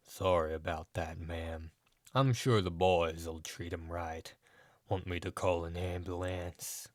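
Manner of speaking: deep, husky wrestler voice; low husky voice. A narrator's reading. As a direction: speak low and husky, gently. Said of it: "with a low, husky voice he said".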